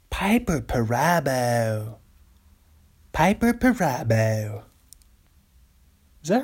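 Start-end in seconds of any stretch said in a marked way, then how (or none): 0.1-4.6 lightly voiced slow announcer reading of a name; a hint of a slow, drawn-out critic voice; Dave Damashek impression. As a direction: savour the name like a film critic host. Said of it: "this is my Dave Damashek".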